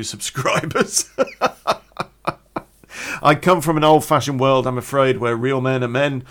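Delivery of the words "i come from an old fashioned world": usual speaking voice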